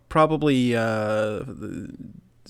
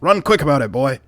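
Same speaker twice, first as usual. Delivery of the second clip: put-on ordering the boy; 5 semitones higher, 9 dB louder than usual. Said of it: "just says".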